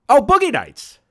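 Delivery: acted delighted aha; sudden realization. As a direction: blurt it as the answer hits you. Suddenly gets the answer.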